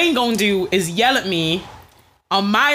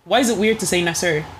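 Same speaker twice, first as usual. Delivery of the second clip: normal talking voice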